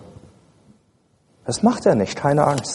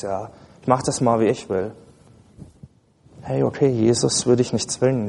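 ordinary talk

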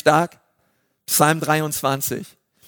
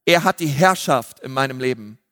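ordinary talk

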